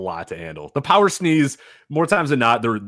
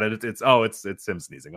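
ordinary talk, nothing marked

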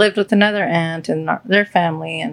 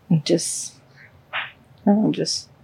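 choked, halting words with the voice breaking; fighting tears while admitting the hurt; holding back tears; 4 dB quieter than usual. Real, not acted. Telling her partner about coming out, near tears. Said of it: "Don't cry".

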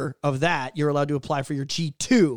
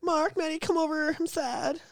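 mock whiny coworker; 11 semitones higher, 4 dB quieter than usual. Mocking Jessica.

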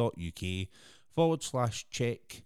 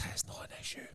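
whispering; 6 dB quieter, faster than usual. Real, not acted. Aside dropped into a whisper.

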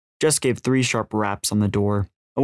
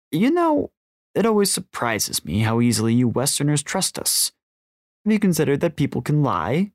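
higher-pitched, smooth villain lecture; smiling older man, condescending; 5 semitones higher than usual. A narrator's reading. Older man gloating to Jess, condescending courtesy. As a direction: toy with the victim, politely.

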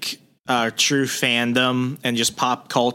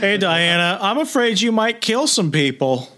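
much higher, laughing mock-prissy Superman voice; mock whiny Superman; 8 semitones higher than usual. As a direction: parody Superman as a fussy worrier, giggling.

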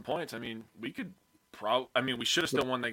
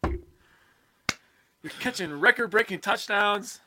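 high, loud cry of playful exasperation; mock exasperated yell; 9 semitones higher, 7 dB louder, much slower than usual. Fan pretending to scold his favorite receiver, exasperated yell. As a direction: holler it at him in mock outrage. Mock outburst at player Alan.

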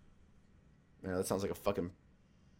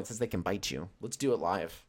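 ordinary delivery

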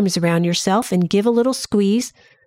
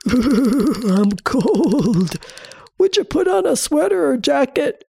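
rising to higher, pleading; cold body voice; 4 semitones higher than usual. Acted. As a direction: ask like a chilly little body. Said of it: "That is probably your body saying".

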